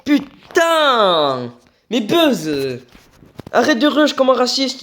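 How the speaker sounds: high, loud angry yell; raging gamer. Real, not acted. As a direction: blow up at an enemy player. Enraged at enemy Buzz. (il crie de rage contre Buzz, fort et aigu)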